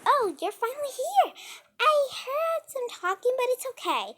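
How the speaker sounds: very high, singsong creepy friend; Samantha. Retold or imitated. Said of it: "my Samantha was like".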